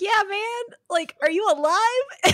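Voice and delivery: thrilled to hear a friend alive; excited reply. Retold or imitated. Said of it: "And I was like".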